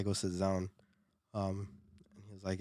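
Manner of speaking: ordinary talk, nothing marked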